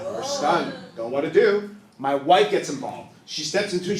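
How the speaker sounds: ordinary delivery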